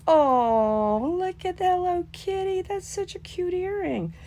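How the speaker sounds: very high, drawn-out squeal of delight; delighted gushing. Real, not acted. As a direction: gush over an adorable find. Delighted find of cute earring.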